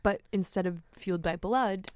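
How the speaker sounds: normal talking voice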